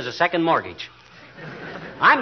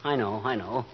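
soft, lower, gentle comfort; quiet remorseful husband comforting his wife; tender apology; 5 semitones lower, 6 dB quieter than usual. Acted. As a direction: soothe your crying wife. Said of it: "you got tears in your eyes".

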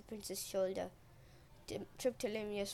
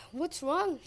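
raised, louder questioning; urgent demand; 6 semitones higher, 6 dB louder than usual. A narrator's reading. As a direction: demand an answer at once. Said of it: "Triptolemus demanded".

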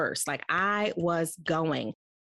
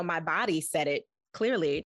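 ordinary talk, nothing marked

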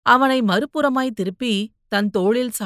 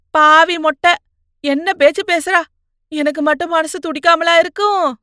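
higher, louder, ranting mother; loud scolding; 7 semitones higher, 7 dB louder than usual. Acted. Mother protests loudly. (மகளிடம் சத்தமாக திட்டித் தீர்க்கும் அம்மா)

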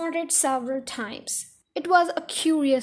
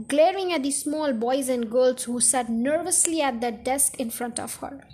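usual speaking voice

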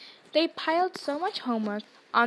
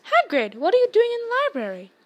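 high, quick surprised question; surprised call; 9 semitones higher, 7 dB louder, faster than usual. Acted. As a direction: blurt it out in surprise. Ron surprised seeing Hagrid.

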